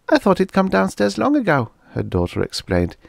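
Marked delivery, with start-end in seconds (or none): 0.1-1.7 put-on much higher, louder girl's voice; a girl reporting with urgency; teenage girl. A narrator's reading. Daughter telling her parents the Martian is still inside, girl voice.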